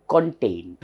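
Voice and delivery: "contain" with a full "kon" first syllable; mispronounced contain. Shown as a demonstration. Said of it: "tempted to pronounce as".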